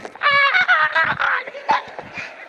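high, stuttering mock sobs; child's sobbing wail. Crying daughter coming upstairs, imitated high sobbing wail. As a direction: imitate a bawling child climbing stairs. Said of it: "you hear just coming up".

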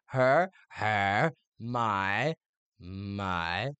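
Her and my each sounded out, then echoed; measured lesson echo of her, my; syllable-by-syllable coaching. Retold or imitated. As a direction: teach her and my one slow syllable at a time. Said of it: "I can imagine her being like".

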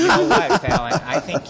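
high and loud through a laugh. Real, not acted. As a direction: burst out laughing as you start. Laughing after kid's joke.

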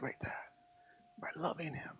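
soft whispered plea. Acted. Pastor urging the church to love God, whispered. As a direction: breathe it out.

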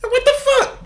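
high squeaky mock yelp of outrage; high imitation of a teen's indignant yelp; mock outraged teen. Girl's friend reacts, mimicked.

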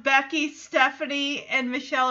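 ordinary talk

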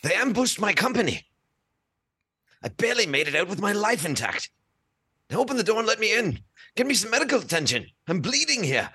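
put-on urgent wounded officer in a high British Imperial accent; hurt Imperial officer pleading at the door, British accent. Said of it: "proper nineteen seventies, nineteen eighties British Imperial accent".

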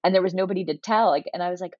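ordinary delivery

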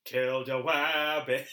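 slow, lightly voiced Fudd goal; Elmer Fudd. Fudd declaring his goal, slow deliberate. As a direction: state it slowly like a fixated hunter. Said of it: "slipped from Elmer Fudd into Truman Capote".

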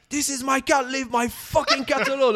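high, loud re-enacted yell; retold shout. Owner shouting at the man grabbing his cat, high retold shout. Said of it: "I'm like, shouted".

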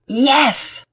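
put-on loud, high-pitched little boy's yes; loud answer. A narrator's reading. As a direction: shout it out as the child. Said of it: "then answered loudly".

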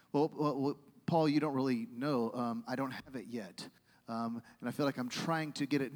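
low, soft, halting doubt; hesitant objection. Retold or imitated. Congregant tells Paul they lack it, hesitant. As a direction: imitate a reluctant doubter, voice dropped.

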